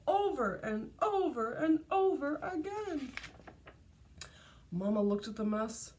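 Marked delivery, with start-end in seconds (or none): none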